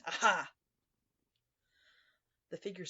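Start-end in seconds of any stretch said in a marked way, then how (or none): 0.0-0.5 loud, triumphant shout. A narrator's reading. Narrator springing on the suspect at the door, shouting. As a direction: burst out with a loud gotcha.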